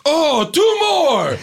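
put-on high, shouted Mario-style Italian accent; mock Mario Italian. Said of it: "everybody sounds like it's Mario Brothers".

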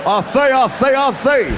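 put-on Foghorn Leghorn Southern accent. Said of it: "That foghorn leghorn".

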